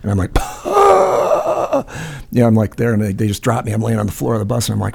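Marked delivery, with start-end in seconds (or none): 0.3-1.8 long, high gasp for air; drawn-out, high-pitched recovery gasp; imitated huge gasp. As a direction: act out air rushing back after choking. Retold gasp as candy pops out.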